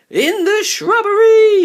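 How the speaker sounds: very loud, high hunting shout. A narrator's reading. Barney shouts to the hunters in the garden, very loud. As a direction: yell to point the chase. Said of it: "shouted Barney".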